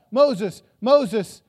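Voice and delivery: loud call of Moses' name; crying out. Retold or imitated. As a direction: cry out to Moses, begging him to stop. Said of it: "you cry out".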